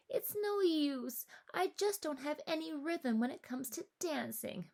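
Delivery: put-on a frustrated child giving up; fretting girl. A narrator's reading. Matisse despairing over her dance steps, high and fretful. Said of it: "Matisse fretted".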